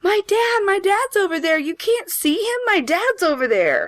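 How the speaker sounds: put-on little girl screaming that her dad is there, very high panicked; terrified child. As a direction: point at the ghost in terror. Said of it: "I'm like".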